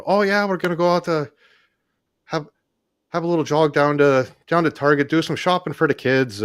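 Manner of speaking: hamming up an Irish lilt about Target; imitating Irish accent. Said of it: "I don't know if that accent is racist".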